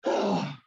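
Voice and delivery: audible release sigh; big sigh. Shown as a demonstration. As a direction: breathe it all out in a sigh. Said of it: "give a big sigh and let it go".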